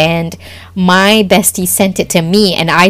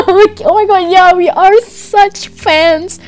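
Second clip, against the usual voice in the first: laughing; 13 semitones higher, 4 dB louder than usual. Real, not acted. Cracking up about being broke fans, high laughing. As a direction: let the laugh lift every word.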